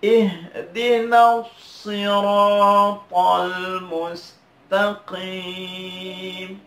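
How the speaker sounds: SIRATAL chanted slowly with a heavy sad; careful model recitation. Shown as a demonstration. Teacher repeats for learners to watch, careful chant. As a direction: recite with long held notes, thick s in "siratal". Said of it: "saya ulangi lagi, diperhatikan".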